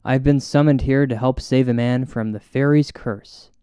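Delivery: ordinary talk, nothing marked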